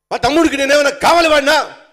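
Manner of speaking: loud, high angry shout; furious yell. Retold or imitated. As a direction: blow up at the question. Brother asked about brother, explodes. (తమ్ముడి గురించి అడిగితే కోపంగా అరుపు)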